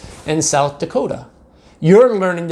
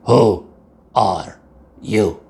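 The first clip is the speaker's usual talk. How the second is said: lower, slow gang boss demand; gruff question; 6 semitones lower, much slower than usual. A narrator's reading. Don Diego demanding who he is, lower and slow. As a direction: drop down and menace.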